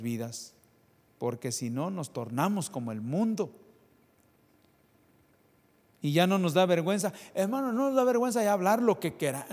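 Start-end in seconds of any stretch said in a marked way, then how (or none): 6.0-9.5 rising scolding sermon. Acted. Preacher's rising, fast rebuke.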